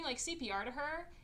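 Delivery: ordinary talk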